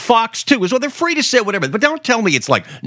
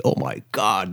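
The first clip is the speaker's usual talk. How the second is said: ordinary talk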